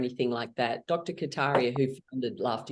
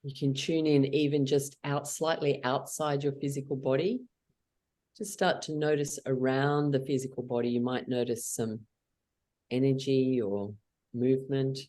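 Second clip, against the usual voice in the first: guided meditation; 2 semitones lower than usual. Acted. Leader guides the group through a calm meditation. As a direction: guide them gently inward.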